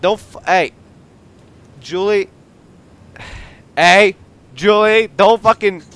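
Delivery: real high, loud yelling; startled shouts, broken off; alarmed shouting. Real, not acted. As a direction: yell to stop someone. Startled, yelling at Julie in game.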